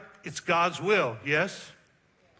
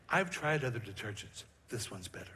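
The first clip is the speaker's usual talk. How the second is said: hushed, lowered mock commercial voice; mock detergent ad; 8 semitones lower, 7 dB quieter than usual. Pastor jokes Jesus does a detergent commercial, mock ad voice. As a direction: sell it like a quiet TV ad. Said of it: "Jesus doing a laundry detergent commercial".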